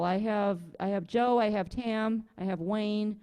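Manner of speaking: ordinary delivery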